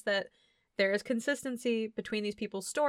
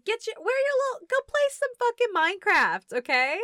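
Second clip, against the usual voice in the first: high, fast, louder mock scolding; mock scolding kid; 11 semitones higher, 4 dB louder, faster than usual. Ranting at the film's son to go play games, high and loud.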